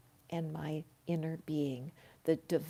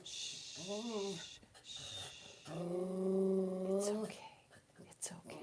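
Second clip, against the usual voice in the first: much softer hush to a whisper; 7 dB quieter than usual. Real, not acted. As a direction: quietly shush the dog. Hushing the dog.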